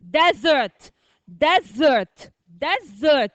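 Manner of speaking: "Desert" called out firmly, falling each time; model pronunciation. Shown as a demonstration. Teacher drills the new word with class, model pronunciation. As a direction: drill "Desert" in two clear syllables. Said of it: "what is this word?".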